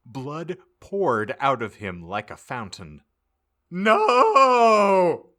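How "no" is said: high, loud dying scream; loud high agonized no. A narrator's reading. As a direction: scream it as the blood pours out. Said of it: "he screamed".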